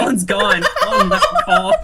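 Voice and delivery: high-pitched words through laughter; giggling that the guest left the call; laughing. Real, not acted.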